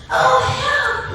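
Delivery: high-pitched yelp for help; panicked. Acted. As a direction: squeal it in storybook fright. Fox panics at the gruffalo.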